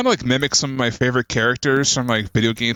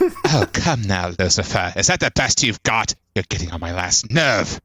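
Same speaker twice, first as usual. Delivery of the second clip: Dark Lord Azdaroth voice; 2 semitones lower than usual. Pretend. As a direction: sneer down at Lucifer, unhurried. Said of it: "Dude, do the voice".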